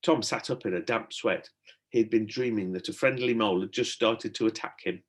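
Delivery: ordinary delivery, nothing marked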